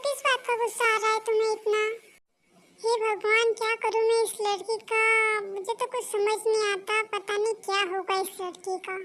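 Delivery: ordinary delivery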